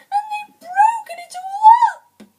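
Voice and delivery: loud, very high tearful squeal; tiny wee bear voice. A narrator's reading. Baby Bear with his broken chair, tiny wailing voice. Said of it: "in his tiny wee voice".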